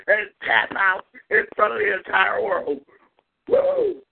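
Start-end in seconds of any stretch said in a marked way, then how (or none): 3.5-4.0 high wrestling-promo woo, yelped out; Ric Flair woo. Retold or imitated. Host imitating Ric Flair in a wrestling promo, piercing high woo.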